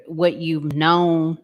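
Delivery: ordinary talk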